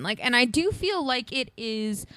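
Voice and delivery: normal talking voice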